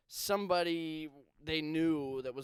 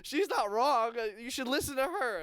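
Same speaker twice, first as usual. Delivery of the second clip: higher, louder imitated teacher; 9 semitones higher, 4 dB louder than usual. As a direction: play the flustered teacher defending her colleague. Teacher answers student's challenge.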